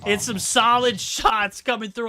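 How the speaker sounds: loud, high hyped casting; hyped shout. Real, not acted. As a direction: yell it like a big play. Hyped play-by-play, manager clutch.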